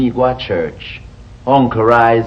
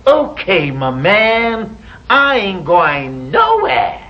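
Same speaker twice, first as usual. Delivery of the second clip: put-on slow, drawn-out American accent; a Jamaican trying an American accent, dragging it out; mock American accent; 5 semitones higher, much slower than usual. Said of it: "Your American accent".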